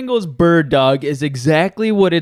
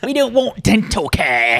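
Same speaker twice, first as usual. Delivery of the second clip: higher, hammed-up British chant; mock British accent; 4 semitones higher than usual. Brit refusing dental care, mock British accent. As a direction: play a cartoon Brit. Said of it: "I like the British act".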